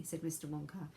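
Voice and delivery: usual speaking voice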